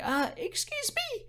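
very high, soft, squeaky disbelief; mock affronted. Retelling a reply to the date's criticism, high mock-affronted. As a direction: act out being taken aback, voice shooting way up. Retold indignant reply to date.

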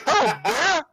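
high sing-song beer vendor cry; stadium vendor hawking. Shown as a demonstration. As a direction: call it out over the crowd. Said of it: "I said the same thing every time the same way and see if I can recreate it".

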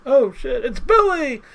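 high-pitched, rising mock excitement; excited exclamation. Fan spots someone called Billy in line, excited exclamation. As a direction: exclaim it like a surprise star sighting. Reacting aloud on meeting Billy.

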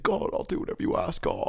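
high, rushed surrender to God; retold repentant plea. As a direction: blurt it out as a hurried, pleading promise. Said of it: "fell on my knees and repented".

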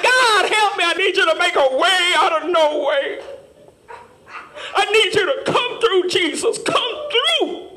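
pleading cry to God, voice rising high; mock desperate prayer. Churchgoer mocking a desperate prayer, pleading. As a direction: beg God for rescue like you're broke. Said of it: "Then we wanna cry out to God".

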